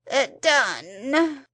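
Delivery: loud, breathless single word; puffed-out creature. A narrator's reading. The Psammead grants Lady Chittenden's wish, puffed out. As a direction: gasp it out after effort.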